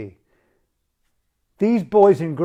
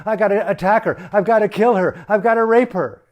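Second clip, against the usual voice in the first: mock inner rage; 5 semitones higher, 6 dB louder, faster than usual. Voicing the insecure general's mind.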